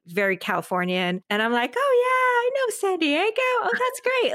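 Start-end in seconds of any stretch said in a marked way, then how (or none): 1.8-4.3 high, bright chatty politeness; small talk. Retold or imitated. Chatting with the guest about San Diego, high bright chatter. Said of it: "I'm just making the small talk".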